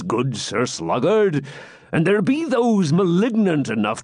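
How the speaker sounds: put-on a jovial old friar, slightly drunk; creaky old hermit. Hermit excuses the revelry.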